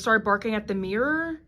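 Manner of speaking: ordinary talk, nothing marked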